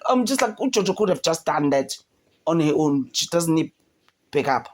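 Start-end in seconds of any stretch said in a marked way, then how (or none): none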